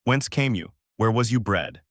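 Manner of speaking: ordinary delivery, nothing marked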